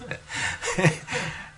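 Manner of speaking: high happy chuckle imitating the guru; high-pitched chuckling; happy laughter. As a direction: laugh happily, as he did. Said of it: "he started laughing like this".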